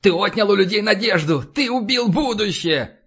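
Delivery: high-pitched, outraged accusation; furious shouting. A narrator's reading. Freed captive accusing his captor of killing hope, acted furious cry. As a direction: splutter it out high, dragging key words. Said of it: "брызгая слюной, закричал".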